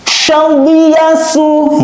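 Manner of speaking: high, drawn-out chanting of the syllables; Quran recitation. Shown as a demonstration. Teacher recites for pupils to copy, high and drawn out. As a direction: chant it like a tajwid teacher showing the sound. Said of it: "Perhatikan Ustadz".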